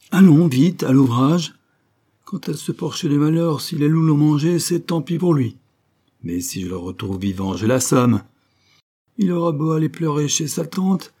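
loud and high, barking orders and threats; angry shout. A narrator's reading. The father shouts at his sons about the runaway swineherd, loud and furious. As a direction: bellow at the lads to get to work.

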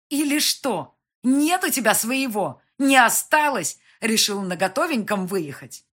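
loud, high, biting questions; angry taunting. A narrator's reading. Mother challenging his claim to the son, angry taunting.

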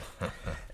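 short, quiet chuckle; brief, rueful little laugh; self-mocking chuckle. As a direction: laugh softly at yourself. Laughs at his own poor English.

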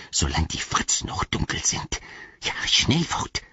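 whispery pleading creature voice; Gollum voice. A narrator's reading. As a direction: coax him like a wretched creature. (flehende Gollum-Stimme)

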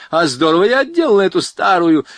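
high, loud boasting call; boastful shout. A narrator's reading. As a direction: crow it loudly to the passers-by. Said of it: "крикнула она". (громкий хвастливый выкрик)

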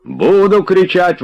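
high, drawn-out yell; defiant yell. A narrator's reading. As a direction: bawl it in defiance. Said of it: "вопил Тарантьев".

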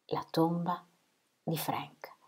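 very soft, sinking almost to a whisper; hushed disbelief. A narrator's reading. Woman struck by the thought of his grave, hushed. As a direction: let the thought fade away in halting pieces. Stunned echo of a dreadful thought.

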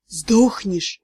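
deep, low questioning voice; sea god. A narrator's reading. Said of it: "странный бог морских глубин". (низкий голос морского бога)

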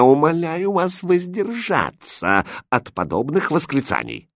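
high-pitched pleading reproof; scandalised governess begging for restraint; indignant. Acted. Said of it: "прошипела возмущённая гофмистерина". (возмущённо, умоляюще)

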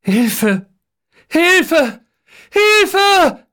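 cries building to a high scream; escalating panicked shrieks; screaming for help. A narrator's reading. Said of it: "schreit Geißler".

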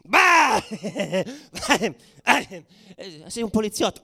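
loud high jeering yell breaking into a laugh; mocking shout. Rioters jeering at the exposed cop, laughing taunting shout.

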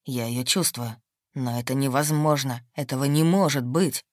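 low, stunned male voice; low disbelief rising to outburst; low shocked voice. A narrator's reading.

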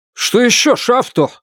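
high, impatient officer tone; commanding. A narrator's reading. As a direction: snap back like a commander. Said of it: "снова чувствует себя командиром". (командирский тон)

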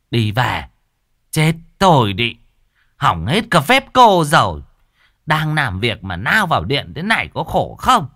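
loud, exasperated telling-off; angry scolding. A narrator's reading. Cô An scolding the neighbour for ruining her ritual. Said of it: "chỉ tay ra ngoài nói".

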